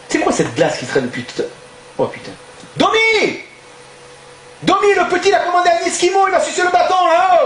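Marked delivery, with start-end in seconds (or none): 2.8-7.4 high, calling across the room; yelling at the waiter. Acted. Boss shouting to his waiter Domi across the restaurant, high call. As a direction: holler for Domi from the counter.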